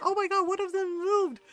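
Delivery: high, loud, escalating mock terror; hammed-up panic, building to the last word; panicky horse. Horse thinks a stick moved, mock terror. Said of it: "He's like".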